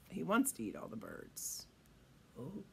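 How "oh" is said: ordinary delivery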